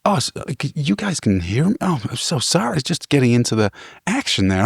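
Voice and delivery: sheepish apology. Retold or imitated. Fight fan apologising to the woken hostel, sheepish. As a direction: imitate a guest caught out and apologising. Noisy guest's retold apology.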